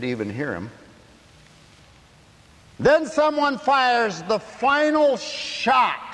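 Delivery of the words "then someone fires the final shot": high, loud, slow and heavy; slow shouted preaching. Acted. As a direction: drag out each word from the pulpit. Preaching at full force.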